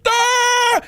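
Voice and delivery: high, loud retold scream; mock a sudden scream of pain; startled scream. Teller reliving burning the hands, high scream.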